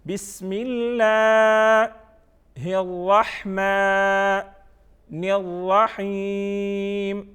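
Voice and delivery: بسم اللہ held long, then a pause; slow separated chant, الرحمن and الرحیم stretched level; recited by parts. Shown as a demonstration. Teacher giving the three parts of tasmiya, slow separated recitation. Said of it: "first one کیا ہے؟".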